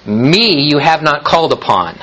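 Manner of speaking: Me set first and leaned on; stress on Me. Shown as a demonstration. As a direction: open by leaning on "Me". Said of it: "the Hebrew puts it at the very first".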